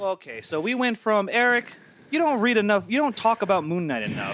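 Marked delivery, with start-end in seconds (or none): 1.3-4.3 mock nagging friends. Mocking friends' complaints.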